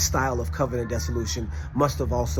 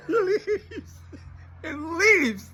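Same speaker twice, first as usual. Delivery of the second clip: laughing in disbelief, much higher; much slower than usual. Real, not acted. Telling how the professor walked out, laughing. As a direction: squeal it out through laughter.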